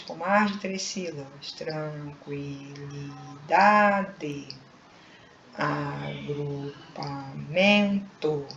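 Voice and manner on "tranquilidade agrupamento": "tranquilidade, agrupamento" very slow and lower, syllables separated. Shown as a demonstration. Teacher giving polysyllable examples, very slowly syllable by syllable. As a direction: count out every syllable slowly. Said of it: "quando pronunciamos lentamente".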